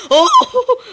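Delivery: very high, loud "oh"; real jolt, voice leaping up; startled yelp. Real, not acted. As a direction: yelp in sudden surprise, half laughing. Sudden shock during fight.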